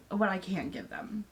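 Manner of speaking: ordinary talk, nothing marked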